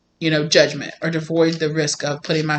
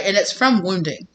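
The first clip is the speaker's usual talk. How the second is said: normal talking voice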